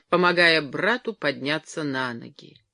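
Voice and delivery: plain reading voice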